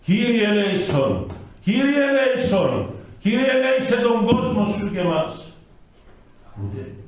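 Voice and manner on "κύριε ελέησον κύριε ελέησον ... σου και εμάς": priest's frightened prayer. Retold or imitated. The priest praying aloud as the earth shakes, high pleading. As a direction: cry out the prayer in fear. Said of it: "Τι έλεγε, νομίζετε, ο καλός παπάς".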